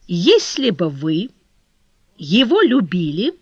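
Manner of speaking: slow, deliberate build-up, swooping on key words; stagey, drawn-out insinuation; theatrical. A narrator's reading. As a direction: draw it out like an actor saving the big moment. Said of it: "точно актёр, сберегающий эффектные места".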